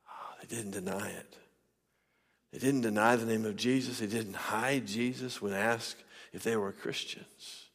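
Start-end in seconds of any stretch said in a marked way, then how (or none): none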